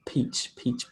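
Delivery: "peach" with a soft puff of breath; soft breathy "peach, peach"; quiet aspirated "peach". Shown as a demonstration. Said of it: "coming more from the front".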